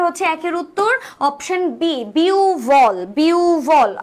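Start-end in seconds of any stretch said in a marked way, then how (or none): 2.1-4.0 "বিউভল" with a long biu, then a falling bhol; correct pronunciation. Shown as a demonstration. Teacher gives students the right answer, "বিউভল" drawn out as biuu-bhol. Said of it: "তাহলে right answer কি".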